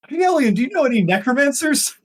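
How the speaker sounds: high, squeaky voice; high-pitched voice. Acted. GM shifts to character voice.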